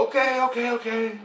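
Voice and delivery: high, softening placating reply; giving in. Retold or imitated. Dreamer relenting to his family, high placating voice. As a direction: imitate someone relenting, pitched high.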